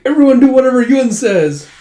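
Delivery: high, loud proclamation; hammed-up decree; rallying command. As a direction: order the whole group at full voice. Retold decree to the whole group.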